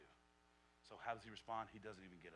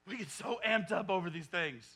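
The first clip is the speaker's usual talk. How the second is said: loud, high, fired-up sermon delivery; pulpit-pounding preacher at full volume; full-force preaching; 22 dB louder than usual. Acted. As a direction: preach it out to the whole church.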